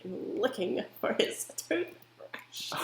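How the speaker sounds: slow, hissed whisper; venomous whisper. Retold or imitated. Daughter whispering her mom's bitter line. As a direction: hiss it slowly through your teeth. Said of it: "she said it with such, like, venom".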